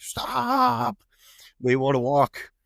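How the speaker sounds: high, drawn-out mock yell on "Stop"; retold calling out. Retold plea to halt rushing rescuers.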